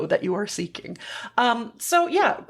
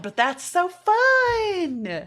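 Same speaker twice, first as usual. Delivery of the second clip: 1 delighted; 8 semitones higher, much slower than usual. Real, not acted. Delighted by accent talk.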